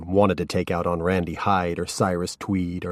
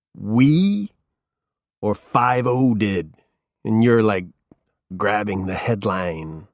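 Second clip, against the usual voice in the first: mocking drunk; 3 semitones higher, 4 dB louder, slower than usual. A narrator's reading. Drunk Norman sneering at the detective's claim. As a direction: needle him about the headline.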